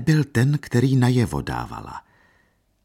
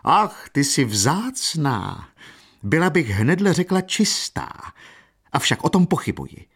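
mock her like a vulgar market woman; coarse mockery; 8 semitones higher than usual. A narrator's reading. Dona Paolina jeering at Katerina, coarsely mocking. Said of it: "zasmála se smíchem hlučným a sprostým".

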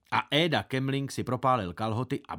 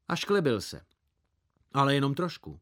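usual speaking voice

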